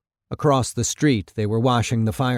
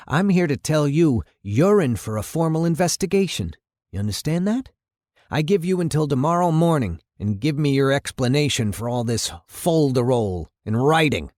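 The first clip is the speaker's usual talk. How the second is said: stern angry mayor; 4 semitones higher than usual. Acted. Mayor announcing a formal investigation to the chief, stern. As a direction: lay down the law as an irate mayor.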